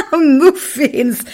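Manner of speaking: high word through laughter; laughing. Acted. Raga skrattar åt ordet, fnissande. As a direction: blurt it out while laughing. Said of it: "Raga skrattade så han kiknade".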